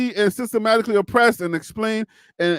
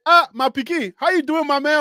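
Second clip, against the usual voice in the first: higher-pitched African-accented greeting; 7 semitones higher, 3 dB louder than usual. Shown as a demonstration. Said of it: "like an accent".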